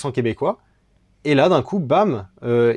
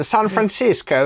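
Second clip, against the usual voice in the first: high, drawn-out American "San Francisco"; American accent; 10 semitones higher, 4 dB louder, slower than usual. Shown as a demonstration. Finding a better city name for the joke, exaggerated American accent. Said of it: "ils le disent avec l'accent".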